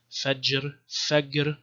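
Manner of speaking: ordinary talk, nothing marked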